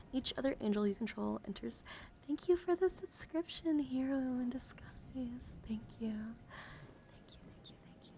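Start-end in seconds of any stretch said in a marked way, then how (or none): 2.3-6.1 high, drawn-out sweet thanks; sing-song gratitude, pitched well up; delighted thanks. Real, not acted. Streamer thanking a new subscriber, delighted. 6.1-8.1 very soft whispered thanks; ASMR whisper. Real, not acted. Streamer thanking a subscriber, whispered.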